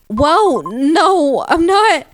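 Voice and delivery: very high, loud yelped no; alarmed denial. Real, not acted. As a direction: cry out the denial. Player denies being a cheater.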